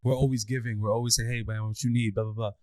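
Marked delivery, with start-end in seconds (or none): none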